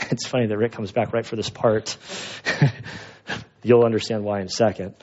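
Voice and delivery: amused aside broken by little laughs; laughing. Real, not acted. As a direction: break into a chuckle while explaining. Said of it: "you made me laugh".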